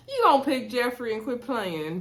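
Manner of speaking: ordinary talk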